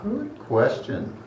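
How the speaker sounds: musing trickster deity, drawing out the advice; whimsical god. Acted. God advises a follower to make it random, whimsical.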